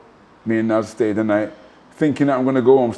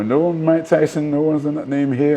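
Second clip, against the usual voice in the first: hotel clerk; 5 semitones higher than usual. Retold or imitated. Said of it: "and they go".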